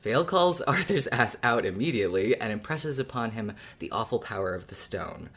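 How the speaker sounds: usual speaking voice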